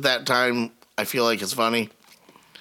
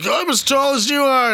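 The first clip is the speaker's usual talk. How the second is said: high, excited boast; excited kid; 13 semitones higher, 4 dB louder than usual. Retold or imitated. Kid measures up to dad, excited high voice. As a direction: imitate an overexcited kid, pitched way up. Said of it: "they get all excited. They're like".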